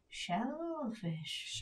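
hushed, stunned echo in panic; panicked. Retold or imitated. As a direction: breathe the word in mock dread. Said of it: "He's fully panicking".

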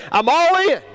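high, exuberant cheer; celebrating rider. Acted. Thrilled rider throwing hands up as the coaster leaves, celebrating. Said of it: "Some people celebrate that".

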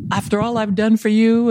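high, mock-aggrieved wail; martyr mother. Mother guilt-tripping her child, hammed-up martyrdom. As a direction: play the long-suffering mother. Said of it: "Mothers are like martyrs".

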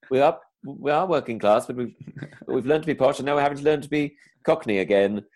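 a hint of posh actor doing Cockney; put-on Cockney with posh training showing; clipped fake Cockney. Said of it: "this sort of kind of clipped".

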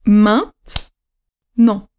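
"maintenant" very slowly, maint, long pause, nant; teacher separating "maintenant" into two isolated beats, middle e silent; two separated syllables. Shown as a demonstration. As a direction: leave a clear gap in "maintenant": maint... nant. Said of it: "Deux syllabes".

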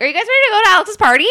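high, loud, rapid burst; mock excited blurting. Sister almost spilling the party secret, mock excited blurt.

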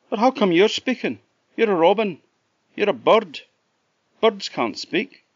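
can't believe a bird is talking; astonished Jack. Acted.

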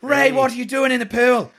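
high hammed-up Irish voice; Irish accent. Irish wife scolding husband in pool. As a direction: ham the Irish wife calling out. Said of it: "an Irish accent".

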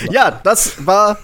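high, loud cheery sign-off; show outro. Acted. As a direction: wrap up like a radio host.

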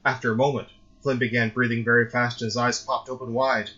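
usual speaking voice

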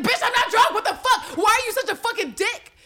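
very high, hammed-up outrage; mock angry yelling. Eve answering remarks about her drinking, high mock outraged yell. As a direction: screech an exaggerated retort.

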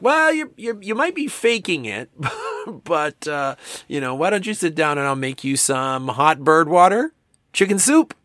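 high, laughing mock mom offering soup; high caring parent voice. Parent fussing over a sick kid, high and laughing. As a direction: chirp it up like a fussing parent who's amused.

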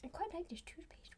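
hushed whisper aside. Real, not acted. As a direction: whisper it to the camera. Aside to the camera.

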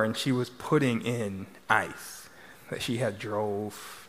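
ordinary talk, nothing marked

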